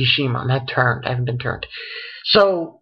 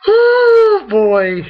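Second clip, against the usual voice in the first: mock despair at the chapter; dramatic groan; 6 dB louder than usual. Real, not acted. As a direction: let out an exasperated cry. Groans before ranting about chapter.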